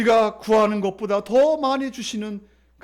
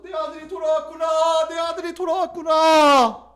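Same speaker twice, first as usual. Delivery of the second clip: joyful father's shout; 5 semitones higher, 3 dB louder than usual. Acted. Father running to his returning son, shouting joyfully. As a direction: call out overjoyed, stretching the last word.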